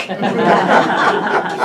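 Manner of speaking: a burst of unvoiced laughter at his own joke; laughing at the Chivas line; breathy laugh. Real, not acted. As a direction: laugh it out, high and airy.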